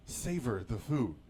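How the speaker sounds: unhurried, drawn-out words; slowed-down self-coaching. Acted. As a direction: coax yourself to linger over each word. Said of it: "No, slow down".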